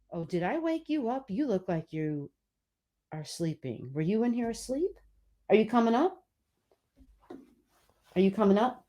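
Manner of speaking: hushed sweet talk to a pet; soft voice to a cat. Real, not acted. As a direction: coo gently at the cat. Talking softly to the cat.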